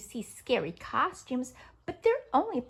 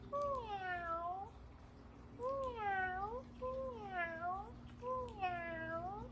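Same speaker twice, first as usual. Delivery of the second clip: cat meow; 7 semitones higher, 12 dB quieter, much slower than usual. Pretend. Acting cat.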